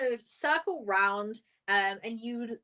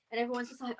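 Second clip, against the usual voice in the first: ordinary talk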